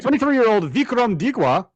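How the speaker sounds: put-on joking Indian accent. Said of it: "I don't know why I did that in an Indian accent".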